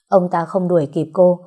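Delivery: ordinary delivery, nothing marked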